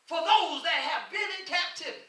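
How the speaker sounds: ordinary talk, nothing marked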